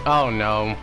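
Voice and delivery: ordinary talk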